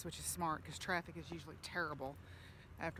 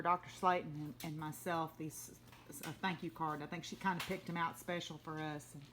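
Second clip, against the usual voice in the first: ordinary delivery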